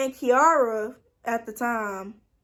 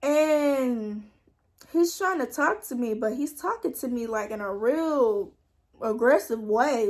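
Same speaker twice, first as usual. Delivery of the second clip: ordinary talk, nothing marked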